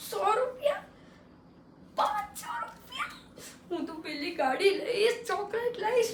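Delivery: squeaky high gasp of delight; excited kid. Acted. Boy watching uncle pull out cash, thrilled and high. Kid sees money coming out.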